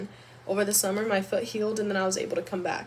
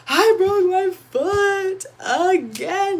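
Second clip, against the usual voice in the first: high, loud, very slow wail; tearful cry of I broke my foot again; retold sobbing; 11 semitones higher, 9 dB louder, much slower than usual. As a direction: relive the crying moment. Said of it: "I was in tears".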